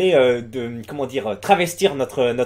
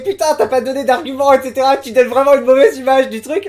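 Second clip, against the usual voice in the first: higher, pressing mock complaint; retold indignant protest; 7 semitones higher, 5 dB louder than usual. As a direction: act out an outraged commenter, louder. Les gens se foutaient de sa gueule et disaient. (protestation indignée rapportée)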